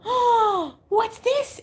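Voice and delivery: excited pet-voice question; cooing at the cat. Retold or imitated. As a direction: tease the cat with a high coo. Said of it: "I go".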